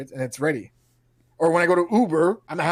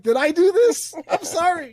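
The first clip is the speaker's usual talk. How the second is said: high, loud mock-guilty wail; hammed-up guilt over the closed McDonald's; joking to a friend, feigning remorse; 13 semitones higher, 5 dB louder than usual. Mock guilt at boarded shop.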